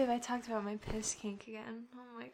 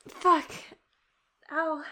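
pained yelp; 2 semitones higher, 9 dB louder than usual. Real, not acted. She knocks a glass on her tooth, yelping in pain. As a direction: cry out as the glass hits your tooth.

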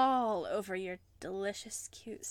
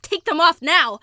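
shouted higher order; loud command; 7 semitones higher, 17 dB louder than usual. Acted. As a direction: bark the order. Commanding partner loudly.

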